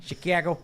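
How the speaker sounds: "Chicago" as shi-kee-AG-oh; mock Chicago accent. Shown as a demonstration. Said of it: "the one thing people think they do say".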